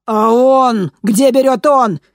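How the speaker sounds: high loud questioning; loud demand. A narrator's reading. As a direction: demand an answer. Interrogator presses on.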